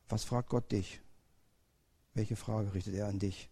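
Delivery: quiet, tender sermon voice; hushed reflection. Acted. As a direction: draw the listeners in gently.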